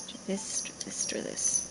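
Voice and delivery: soft unvoiced whisper. Real, not acted. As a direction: hush it to yourself like a secret. Repeats the command, then whispers.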